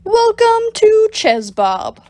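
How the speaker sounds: high, loud welcoming host call; host greeting. Acted. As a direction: sell it like a cheesy maître d'. Bob greets customers.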